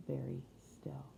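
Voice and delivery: whispered, hushed. A narrator's reading. Narrator describing the silent valley, whispered. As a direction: let the words fade into a whisper.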